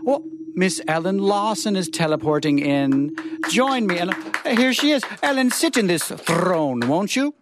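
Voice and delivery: ordinary delivery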